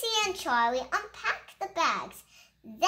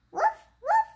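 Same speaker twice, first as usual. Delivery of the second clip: woof woof, much higher like a tiny puppy; high puppy yap; 6 dB louder than usual. Acted. Child reading a puppy book, high puppy yap. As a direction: yip it high like a small dog.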